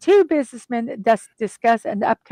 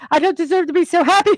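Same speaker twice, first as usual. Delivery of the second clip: giddy Scrooge; 6 semitones higher, much faster than usual. Retold or imitated. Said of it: "he goes".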